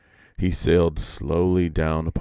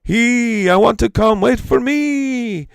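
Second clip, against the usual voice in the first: very high, loud, slow wait for me; calling out; 7 dB louder than usual. A narrator's reading. Iktomi peacock calling after the flying flock, loud high call. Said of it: "the Iktomi peacock called out".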